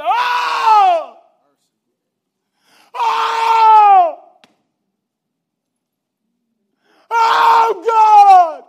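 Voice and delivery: long, high, full-throated wails of anguish; belting anguished cry. Acted. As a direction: belt it out like a grieving man breaking open. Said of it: "And he belts out".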